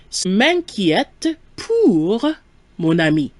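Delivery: word by word, drawn out with pauses; slow model sentence. Shown as a demonstration. Teacher giving learners the answer, slow and segmented. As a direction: stretch each chunk for learners.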